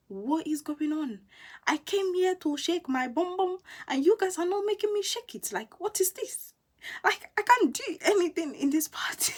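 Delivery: high, whiny disbelief at the party; exasperated complaint. Retold or imitated. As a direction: complain like you came to dance. Frustrated at dead party.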